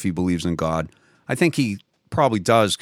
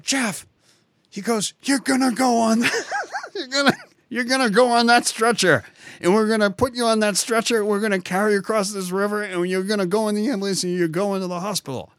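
mock doctor yelling; 11 semitones higher than usual. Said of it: "he yelled, literally yelled at me".

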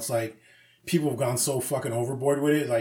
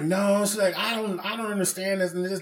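higher-pitched mock protest; imitated complaining customer; 6 semitones higher than usual. Customer arguing with the manager, imitated complaining. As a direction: lightly voice an exasperated customer, pitched up.

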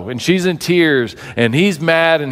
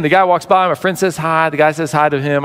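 ordinary talk, nothing marked

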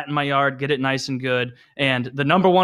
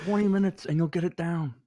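softer jutting-jaw actor impression; Marlon Brando; 5 dB quieter than usual. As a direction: reassure them in a put-on star voice. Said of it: "Anyone can do this impression".

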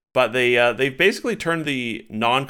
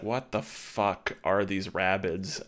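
stoic deadpan; 3 semitones lower, 7 dB quieter, slower than usual. Retold or imitated. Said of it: "so stoic".

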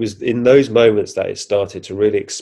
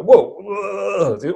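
high, drawn-out whoa; retold amazement; 10 semitones higher than usual. Recalling screens popping up everywhere, amazed. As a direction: imitate being overwhelmed, pitched well up.

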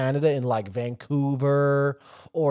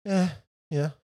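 ordinary delivery

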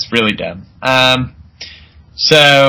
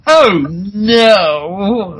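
mock dismay; 6 semitones higher than usual. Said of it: "he's like".

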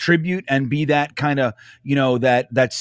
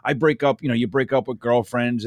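ordinary talk, nothing marked

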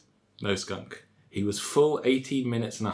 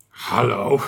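growl; 6 dB louder than usual. Acted.

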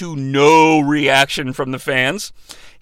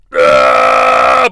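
grunt; 5 dB louder than usual. Retold or imitated. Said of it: "with a fist in the air like".

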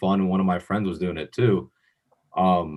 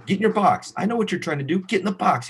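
mock coach yell; 10 semitones higher, faster than usual. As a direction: imitate the coach snapping an order. Coach repeats order.

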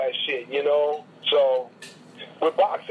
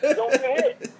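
high laugh-filled repeat; slower than usual. Real, not acted. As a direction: giggle the words out. Laughing at the joke.

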